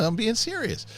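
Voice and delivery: high, over-earnest protest to his daughter; insisting earnestly. Retold or imitated. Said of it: "I go".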